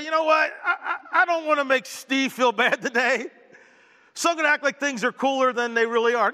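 high, sing-song voice of someone hiding the truth; mock people-pleaser voice. Pastor mocking a dishonest gauge, high and sing-song. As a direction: play the gauge that fakes being cool to spare Steve. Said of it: "Doesn't say".

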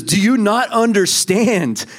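high, slow pleading question; voice of Jesus. Acted. Jesus questioning the disciples, high slow plea. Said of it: "He says".

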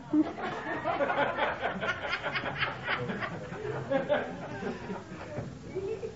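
long high gleeful cackle; high cackling laugh. Retold or imitated. Car owner cackling after wrecking the deal, high and gleeful. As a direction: cackle at the joke's twist.

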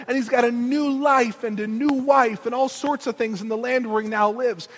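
ordinary talk, nothing marked

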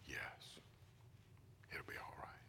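barely voiced hush; whispered voice of God. God answering the father in a soft whisper. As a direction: breathe it like a secret.

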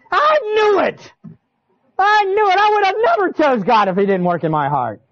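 high, thrilled cry of discovery; excited new believer. Retold or imitated. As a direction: blurt it out like a happy new convert.

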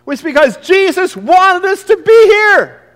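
high, loud slow outcry; anguished cry. Acted. Peter telling listeners why they were there, anguished high outcry. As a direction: cry it out in disbelief.

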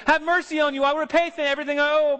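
pleading servant. Acted. Servant begging his fellow servant for mercy, high pleading. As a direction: plead on your knees for time. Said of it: "He pleads with him and says".

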